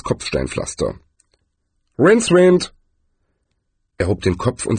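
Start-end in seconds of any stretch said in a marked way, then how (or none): 2.0-2.7 high, loud call of a name; bright hailing shout. Acted. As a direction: call him from down the path. Zweiblum calls out across path.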